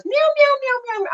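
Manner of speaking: high mock meows like the cat; playful high cat meows for the neighbor; cat meowing. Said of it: "she goes".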